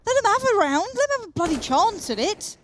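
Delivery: high, loud British outburst. Real, not acted. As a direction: plead loudly, turning extra British. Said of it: "You went even more British then".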